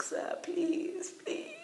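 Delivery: high, drawn-out begging; acted pleading. Student begging sir during caning, acted pleading. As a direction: beg in a high voice, hamming it up.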